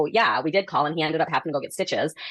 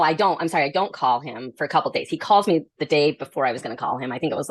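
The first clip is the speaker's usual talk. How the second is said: ordinary talk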